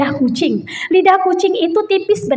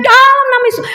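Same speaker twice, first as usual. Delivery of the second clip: re-enacted high, loud shout; teriak; 8 semitones higher, 5 dB louder, faster than usual. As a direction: cry it out to drive off a snake. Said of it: "saya teriak". (menirukan teriakan lantang mengusir ular)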